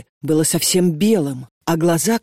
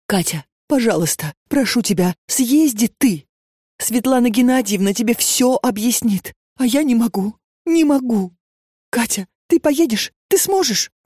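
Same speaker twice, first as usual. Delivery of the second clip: high, pleading, near tears; desperate begging; 6 semitones higher than usual. A narrator's reading. Kneeling Lena begs Katya to go instead, high and tearful. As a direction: beg her friend, voice rising in despair.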